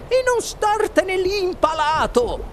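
high, sharp falsetto order; shouting wife. A narrator's reading. Wife yelling at her husband, shrill order. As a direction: screech at an idle husband. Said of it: "gridò la signora Gloop a suo marito".

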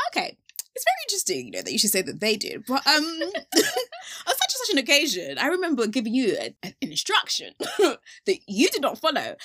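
lightly voiced Lord, high and amused with laughter; gently correcting, voiced as the Lord with a laugh; the Lord speaking. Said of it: "This is literally the Lord talking to me".

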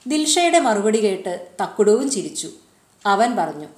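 ordinary delivery

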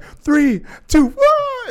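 very high, loud count building up; imitating an instructor before the jump; shouted countdown. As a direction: yell the count, climbing to the last.

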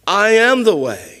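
firm, weighty declaration of I am the way; emphatic. Shown as a demonstration. Preacher declaring Jesus's words to Thomas, emphatically. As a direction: land each word of "I am the way" as final. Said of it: "This is emphatic, absolute".

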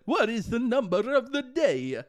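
loud, high vampire showman; almost-Italian cartoon accent, grandly announced; the Count. Recalling a Sesame Street bit for co-host, hammed-up Count voice. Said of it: "of the Count in Sesame Street".

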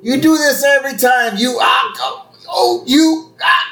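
high, spluttering mock rage; acted outrage. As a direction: rant until you choke on your words. Moe rages at Homer's hand.

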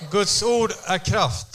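"Guds ord är kraft" spoken very slowly, stress on "ord"; slow lead line. Shown as a demonstration. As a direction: interpreter to congregation, leading the repeat slowly. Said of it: "Säg".

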